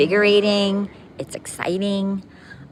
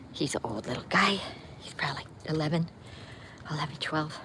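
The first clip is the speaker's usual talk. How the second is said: breathy whisper, much softer; whispered aside; 8 dB quieter, faster than usual. Real, not acted. Telling viewers her dog's age, soft whisper. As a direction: drop to a whisper like sharing a secret. Aside about the old dog.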